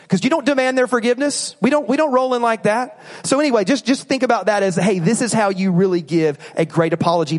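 ordinary talk

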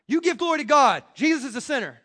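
loud, scornful mimicry of the accusers; hammed-up accuser's sneer; mocking Pharisees. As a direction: throw their charge back at them with scorn.

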